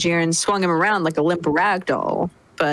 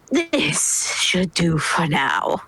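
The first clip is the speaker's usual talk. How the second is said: very slow, measured villain drawl; slow emperor voice; much slower than usual. Acted. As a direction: let it roll out lazily, superior. Frieza after transforming.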